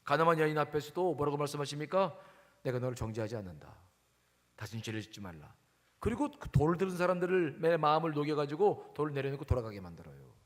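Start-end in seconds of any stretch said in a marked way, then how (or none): 2.6-5.5 low, soft, calm reassurance; gentle pardon. Acted. Jesus to the accused woman, gentle forgiveness. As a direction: lower the voice and pardon tenderly.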